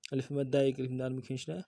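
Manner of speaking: ordinary delivery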